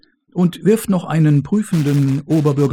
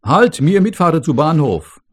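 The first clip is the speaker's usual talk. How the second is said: loud call; 5 semitones higher, 7 dB louder than usual. Acted. Foreign man calls out, broken German.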